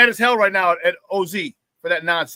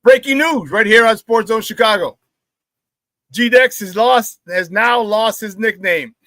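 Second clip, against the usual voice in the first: mock news anchor; 3 semitones higher, 4 dB louder, slower than usual. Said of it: "mock news announcement".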